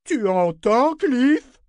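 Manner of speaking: high bawl, climbing on the name. A narrator's reading. Ship to Cliff when nobody reacts, bawling. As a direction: bawl it with a rising end. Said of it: "brailla-t-il".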